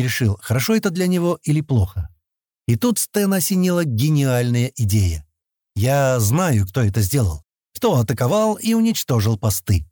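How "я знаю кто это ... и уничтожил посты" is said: usual speaking voice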